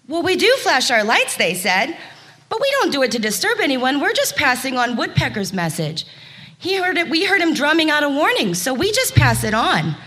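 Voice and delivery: high, quick chirpy fireflies; tiny insects talking fast and high. Acted. Fireflies explain themselves.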